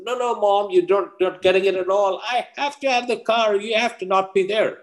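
very high-pitched teenage protest, acted; exasperated son. Son to mother who doesn't get it, acted high-pitched exasperation. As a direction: argue with Mom way up high, exasperated.

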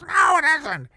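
put-on high, loud old landlady protesting; Mama Jerry. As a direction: squawk back as a stubborn old lady. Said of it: "And she talked like this".

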